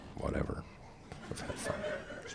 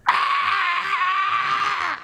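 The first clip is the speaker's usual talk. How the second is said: put-on high shrieking Ah in an English accent; 5 dB louder than usual. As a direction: screech like a startled English mother. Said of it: "In an English accent, of course".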